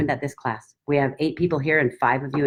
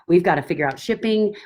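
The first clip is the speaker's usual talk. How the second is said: usual speaking voice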